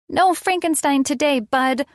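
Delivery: put-on high, kid-like pitch; a small kid standing up to a monster; playful child. A narrator's reading. Child defies play villain.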